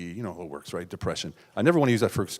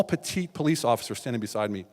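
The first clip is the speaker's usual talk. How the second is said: ordinary delivery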